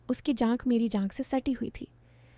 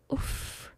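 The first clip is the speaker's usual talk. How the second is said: breathy swoon; 8 dB quieter than usual. A narrator's reading. Swooning over his eyes.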